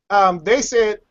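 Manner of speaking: ordinary talk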